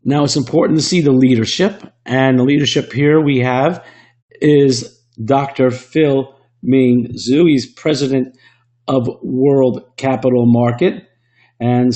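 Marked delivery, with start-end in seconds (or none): none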